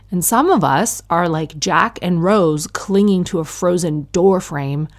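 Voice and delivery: ordinary talk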